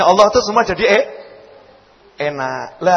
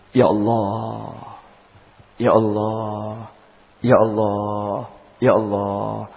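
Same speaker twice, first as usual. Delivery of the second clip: slow, low, breathy-free soft lament of Ya Allah; slow soft repeated Ya Allah; sighing prayer; 9 semitones lower, 8 dB quieter, much slower than usual. Acted. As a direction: sigh it out slowly to God. Preacher voicing a sighing prayer.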